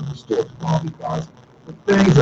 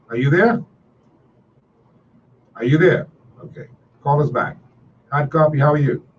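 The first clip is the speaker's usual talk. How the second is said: host picking up a live caller, raised and upbeat; radio host greeting callers; 4 semitones higher than usual. Acted. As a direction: welcome the caller, project it. Answering call-in lines on air.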